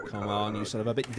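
normal talking voice

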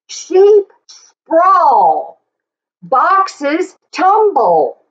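rhythmic read-aloud, leaning on each sheep; reading to children. Acted. Teacher reading the book aloud to the class, in a lively sing-song. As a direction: read it out like a picture book for little kids.

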